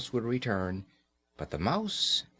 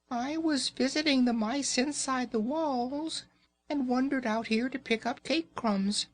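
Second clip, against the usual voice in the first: very high, tiny squeaking mouse; squeaky mouse voice; 13 semitones higher than usual. Acted. Mamma mouse pleading with Raggedy Ann, very high squeak. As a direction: squeak it out like a frightened little mouse.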